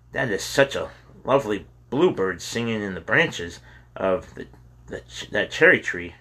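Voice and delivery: usual speaking voice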